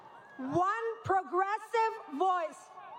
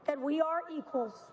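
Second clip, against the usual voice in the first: ordinary talk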